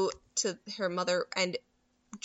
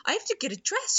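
high excited mock gush; a hint of giddy excitement; excited squeal; 10 semitones higher, faster than usual. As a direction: gush like a thrilled girl. Erica excited about royal invite.

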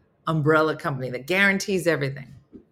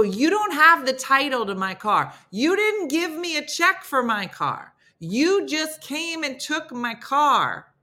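raised, pointed complaint; indignant retelling; 9 semitones higher than usual. Retold or imitated. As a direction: lays out the theft to the tow company, indignant. Confronting the company over her stolen car.

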